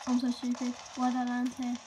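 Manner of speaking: frustrated yelling. Real, not acted. Frustrated at own landing.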